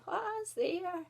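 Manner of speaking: put-on high old granny's call. A narrator's reading. Granny calls to someone at the door, old voice. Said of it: "An old voice called out".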